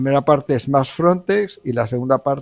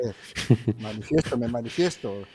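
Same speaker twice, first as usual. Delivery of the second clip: too quiet on mic; 7 dB quieter than usual. Real, not acted. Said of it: "Está bajito".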